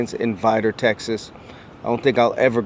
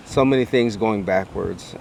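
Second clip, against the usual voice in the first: ordinary talk, nothing marked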